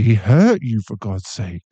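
usual speaking voice

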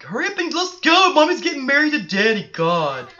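put-on high, loud impatient kid; whiny kid voice. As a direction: shriek it like an impatient child. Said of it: "She's like".

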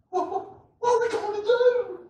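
high, panicked servant voice; Gehazi impression. Preacher imitating Gehazi's panic to his master, high and scared. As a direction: stammer it like a terrified servant. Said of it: "great impression of Gehazi".

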